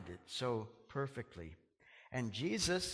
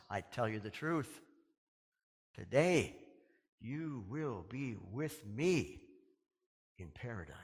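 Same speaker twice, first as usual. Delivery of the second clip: tender promise; 4 semitones lower than usual. Retold or imitated. Jesus to the thief, slow tender promise. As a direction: let each word settle gently.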